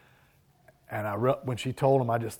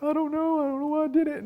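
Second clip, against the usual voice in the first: put-on high, small voice of a tearful little boy; mock-whimpering kid, pitched far up; crying child; faster than usual. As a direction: imitate the child caught out and crying. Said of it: "And I was like".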